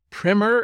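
"primer" said as "primmer"; short-i pronunciation. Real, not acted. Host introducing the guest's topic, "primer" said as "primmer". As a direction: say "primer" with a short i, rhyming with trimmer. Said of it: "Is that how you pronounce it?".

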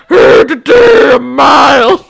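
put-on loud dopey teen boy; dumb boy voice. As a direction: shout it like a dim brute. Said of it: "Doesn't sound like him at all".

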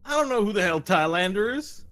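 high, loud outraged sheriff; exasperated small-town lawman shout; yelling up. Acted. Sheriff finding the same troublemakers, yelling up. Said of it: "he's yelling up at you".

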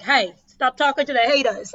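loud imitation of dad's Boston accent. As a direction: put on a broad, loud Boston dad.

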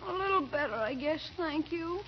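put-on soft, feeble sick-boy voice; put-on frail voice, fading away; faking weak. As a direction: play up being too sick for school. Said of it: "he seems terribly weak".

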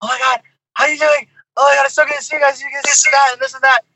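high, fast, gushing excitement; gushing fans. Retold or imitated. Imitating hyped fans greeting the band, fast and gushing. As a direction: gush like an overexcited fan. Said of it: "They're just like".

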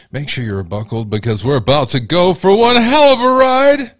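loud, high hype shout; hyped shout. Pretend. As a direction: build up and yell the hype. Captain intro builds to shout.

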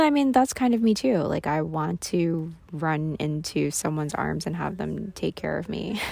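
ordinary talk

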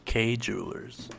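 slow, smooth commercial voice; mock ad read. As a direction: parody radio ad.

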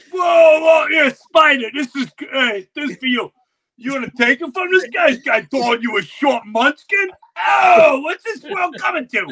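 high, loud mobster heckling; Goodfellas wiseguy. Retold or imitated. Friends retelling a kid fight as mobsters, loud wiseguy ribbing. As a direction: ham up a Goodfellas goon. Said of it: "full-blown Goodfellas".

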